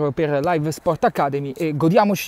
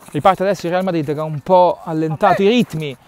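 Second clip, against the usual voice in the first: ordinary talk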